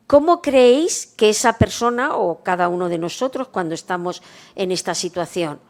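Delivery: ordinary talk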